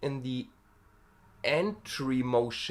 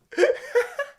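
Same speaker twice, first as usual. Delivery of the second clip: a high-pitched laugh after the scream; 5 dB louder than usual. Real, not acted. Singer laughing at her own scream, high.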